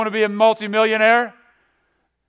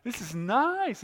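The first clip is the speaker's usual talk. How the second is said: mock relieved delight; 4 semitones higher, slower than usual. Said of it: "and you're like".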